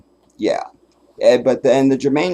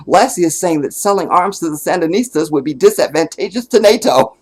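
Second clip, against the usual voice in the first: higher, prim sitcom-mother voice; imitating the TV mom; 6 semitones higher, 5 dB louder than usual. Mother translates the dog's bark for her son, prim and prissy. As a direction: explain the bark like a proper TV mom. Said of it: "she goes".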